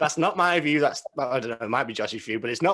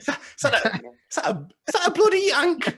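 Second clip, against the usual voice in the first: mock-outraged Englishman, heavy English accent; put-on English accent; 13 semitones higher than usual. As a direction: lay the English accent on thick, stretching it out. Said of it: "putting on an English accent".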